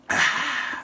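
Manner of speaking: long breathy exhale of relief; satisfied sigh. Real, not acted. Host refreshed after a quick fix, satisfied exhale. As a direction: let out a big contented breath.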